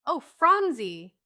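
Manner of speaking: high, loud, drawn-out dismay; slow, dismayed wail of protest; distressed cry. A narrator's reading. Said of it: "cried Polly in great distress".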